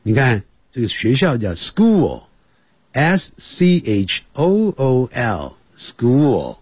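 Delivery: ordinary delivery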